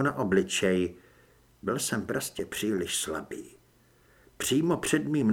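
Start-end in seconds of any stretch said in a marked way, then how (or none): none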